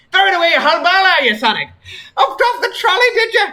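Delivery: high, loud British teasing with a laugh. Acted. Tracer mocks Sonic for binning the bowl, teasing British. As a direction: rib him in a bright British accent. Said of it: "cheery British voice".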